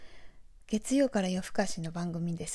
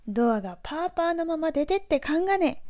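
high-pitched Nagoya dialect scolding example; native Nagoya dialect; 7 semitones higher, 3 dB louder than usual. Shown as a demonstration. Native speaker's example of telling someone off about a door, Nagoya dialect. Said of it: "ネイティブ名古屋弁で例文を作るとしたら".

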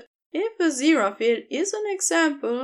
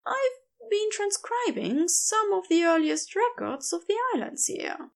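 higher, gentle and eager to please; Aziraphale's voice; 7 semitones higher than usual. A narrator's reading.

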